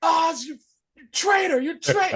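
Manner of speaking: high, strained cry of betrayal; betrayed driver. Retold or imitated. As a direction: shriek the accusation up high. Dying driver accuses Oz.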